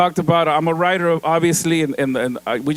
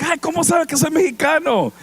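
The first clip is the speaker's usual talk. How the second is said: mock surprise; 10 semitones higher than usual. Surprised reply to Puerto Ricans.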